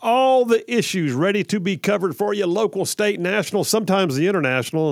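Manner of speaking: loud, high, punchy radio host open; radio show opener. Acted. Radio host greeting his listeners, loud announcer delivery.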